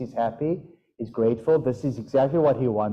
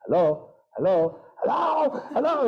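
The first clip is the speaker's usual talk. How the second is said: a frustrated parrot calling for you, pitch rising; frustrated parrot hello; 8 semitones higher than usual. Retold or imitated. Parrot owner imitating frustrated parrot, rising hello calls. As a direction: parrot calling hello, each one climbing higher. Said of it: "if he gets frustrated ... he goes".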